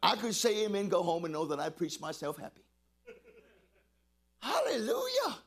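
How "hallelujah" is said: high joyful hallelujah. Real, not acted. Preacher rejoicing.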